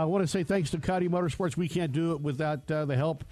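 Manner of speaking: radio ad read. Acted. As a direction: sell the sponsors like a radio host. Sponsor reads on radio.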